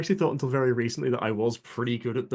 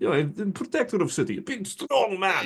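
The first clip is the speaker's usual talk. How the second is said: Russian accent; 9 semitones higher than usual. Shown as a demonstration. Player shows the voice he slipped into, hammy Russian. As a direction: stretch out the last words in a proud Russian accent. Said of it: "Russian accent".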